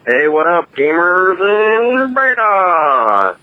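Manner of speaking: high, slow, stretched-out greeting; terrible Fred French impression. Podcast caller mocking a co-host's intro, hammed-up and high. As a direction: drag out the gamer greeting way up high. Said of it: "that was a terrible Fred French impression".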